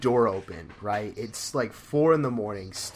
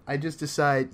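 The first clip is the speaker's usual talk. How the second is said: normal talking voice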